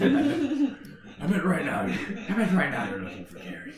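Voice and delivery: put-on Irish accent; Liam Neeson. Caller taunts about missing snail, Irish accent. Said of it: "'Cause you know he's Irish".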